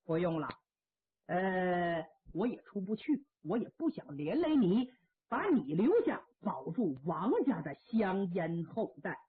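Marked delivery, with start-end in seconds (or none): none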